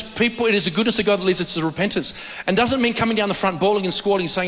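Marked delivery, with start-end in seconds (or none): none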